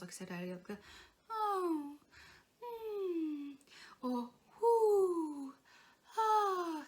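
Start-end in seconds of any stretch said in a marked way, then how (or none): 1.3-6.8 high, falling whimpers; gentle high whines on "oh", "hmm", "whoo"; whingy sounds. Shown as a demonstration. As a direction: sigh down in little whinges. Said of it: "by making the whingy sounds".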